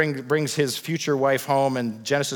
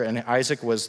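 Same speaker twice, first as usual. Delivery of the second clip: ordinary delivery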